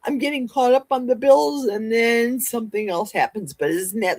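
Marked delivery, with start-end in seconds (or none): none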